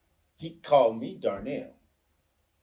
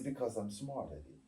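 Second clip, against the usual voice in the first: soft, fast and far softer; cool quiet put-down; 5 semitones lower, 16 dB quieter, faster than usual. Retold or imitated. Man retelling his cool put-down to a rude guest, quietly. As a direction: calmly tell him he's outmatched. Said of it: "I said".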